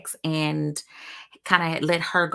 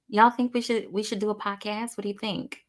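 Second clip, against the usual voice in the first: normal talking voice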